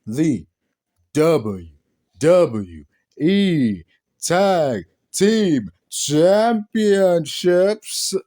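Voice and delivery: very slow, drawn-out ring announcer call; proper announcer read. Shown as a demonstration. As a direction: announce it properly, stretching each word. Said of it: "Let me say it properly".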